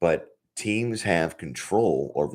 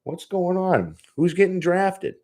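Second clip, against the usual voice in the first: higher, hyped-up mock pestering of the boss's son; mock excited rant; 10 semitones higher than usual. Joking to listeners about pestering Bill, hyped-up and higher. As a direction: ham up an eager fan begging for inside news. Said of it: "So I'm like".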